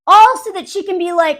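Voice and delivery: normal talking voice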